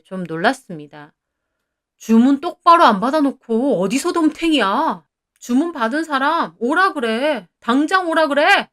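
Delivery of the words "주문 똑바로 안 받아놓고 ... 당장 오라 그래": raised, higher angry rant; furious, agitated demand. A narrator's reading. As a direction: berate the manager rudely and demand action. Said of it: "저렇게 흥분해서 따지는". (흥분해서 반말로 호통치는 말투)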